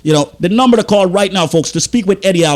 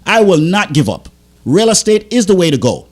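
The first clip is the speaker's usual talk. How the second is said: normal talking voice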